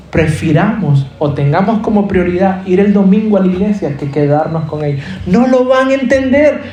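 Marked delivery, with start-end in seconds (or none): 5.3-6.6 high, driving preacher voice, pushing each line; preaching at full force. Acted. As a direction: preach it out to the congregation, urgent and raised. Predica con fuerza creciente. (predicando con fuerza, voz alta y urgente)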